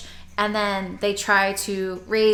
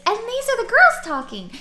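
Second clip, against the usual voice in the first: much higher pitch to play the women; mock raised pitch; 13 semitones higher, 5 dB louder than usual. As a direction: squeak it up high to mimic a female voice. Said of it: "they raise the pitch of their voice".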